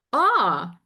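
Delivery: loud, high, slow swaggering bravado; Motherfucker MC impression. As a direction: channel a cocky MC strutting in. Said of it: "what would motherfucker M C do?".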